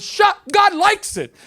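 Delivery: acted teenage defiance, stretched out; shouted retort. High teenage son talks back to mother, slow defiant shout.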